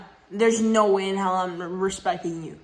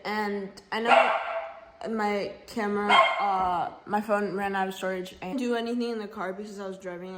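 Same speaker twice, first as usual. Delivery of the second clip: ordinary talk, nothing marked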